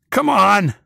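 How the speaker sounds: loud, high scream up at the sky; high-pitched defiant yell; screamed challenge. A narrator's reading. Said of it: "I screamed up at it".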